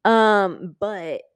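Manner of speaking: ordinary delivery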